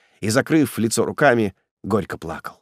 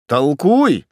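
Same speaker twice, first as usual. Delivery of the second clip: ordinary delivery, nothing marked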